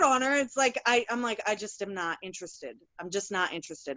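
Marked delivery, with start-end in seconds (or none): 1.5-4.0 uninterested. Retold or imitated. Acting out her boredom with nursing history.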